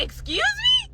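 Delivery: very high shocked squeak; shocked excuse me. Retold or imitated. On hearing the date's job duties, very high shocked squeak. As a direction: squeal it in outrage.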